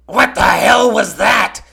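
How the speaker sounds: loud, slow, high shouted cartoon-clown outburst; drawn-out high clown shout of disbelief; Krusty the Clown. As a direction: yell it like a baffled cartoon clown. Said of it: "Krusty the Clown said it best".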